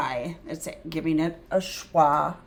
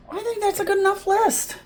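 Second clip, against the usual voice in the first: much higher, fast, silly voice; playful second voice; 12 semitones higher, faster than usual. Pretend. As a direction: play a different character who wants to stop. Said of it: "voicing a different opinion".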